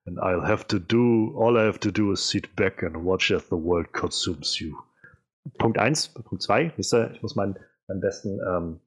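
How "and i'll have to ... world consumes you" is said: put-on slow, low Russian accent; menacing villain in a Russian accent. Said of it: "meinen besten russischen Akzent raussuchen".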